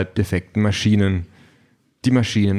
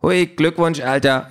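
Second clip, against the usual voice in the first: higher, cheeky gadget voice; Quality Pad; 8 semitones higher than usual. A narrator's reading. Gadget congratulates Peter on his date, cheeky character voice. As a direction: pipe up like a cheeky device. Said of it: "sagt das Quality Pad".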